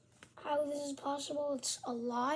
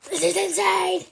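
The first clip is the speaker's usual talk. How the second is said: loud, thrilled exclamation; excited shout; 4 semitones higher, 12 dB louder than usual. Real, not acted. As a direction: blurt it out in delight. First look at new gear.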